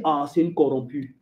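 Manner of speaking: low, falling pronouncement; God's disappointed verdict. Acted. God judging the woman who gave up, low-voiced. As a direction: let the judgement sink deep. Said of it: "Ton Dieu va dire".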